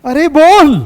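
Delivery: teacher shouting at a silent student; impatient shout. Real, not acted. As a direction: shout at her to finally answer.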